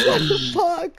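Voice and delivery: high, slow stunned question; shocked disbelief. Retold or imitated. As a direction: drag it out in disbelief. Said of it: "I'm like".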